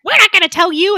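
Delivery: high, loud cartoon defiance; mock defiant yell. Wounded thugs refusing their interrogator, mock defiant shout. Thugs defy the gunman, retold.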